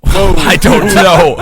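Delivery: loud, slow yell through laughter; laughing shout. Real, not acted. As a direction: shout it while cracking up.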